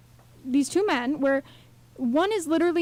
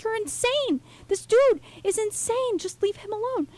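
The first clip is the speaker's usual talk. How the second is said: worked-up disbelief, pitched well up; passionate outrage; 8 semitones higher than usual. Retold or imitated. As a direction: retell an incredulous protest at a movie madman. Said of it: "I'm really passionate about".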